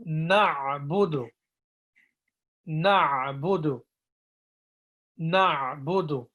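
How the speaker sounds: "na'budu" slow, throaty "a" each time; drilled throat "na'budu"; slow model repeats. Shown as a demonstration. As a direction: repeat "na'budu" slowly for the class. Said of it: "sound strange to pronounce".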